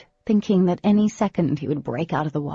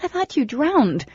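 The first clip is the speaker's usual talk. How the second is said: higher, louder distressed accusations; upset outburst; 6 semitones higher, 5 dB louder than usual. A narrator's reading. Girl confronting the boy who vanished underwater, distraught. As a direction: let the fear spill out as anger.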